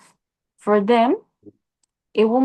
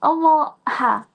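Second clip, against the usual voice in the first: Pidgin outburst; 5 semitones higher than usual. Retold or imitated. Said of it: "acted angry Pidgin outburst".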